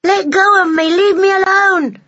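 put-on high, loud shouting child. A narrator's reading. Boy yelling at the woman dragging him ashore, high and loud. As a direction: yell like a boy being dragged. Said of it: "he kept shouting".

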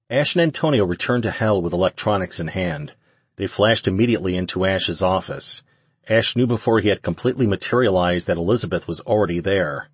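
ordinary delivery

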